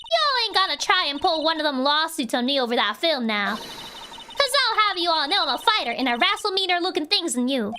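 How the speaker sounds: put-on Southern accent. Photographer fearing a lawsuit, in a Southern accent. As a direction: warn them off in a Southern accent. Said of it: "see pull it off correctly".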